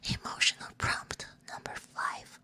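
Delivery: whispered ASMR reading and chat. Real, not acted. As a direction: whisper it all, unvoiced words close to the listener.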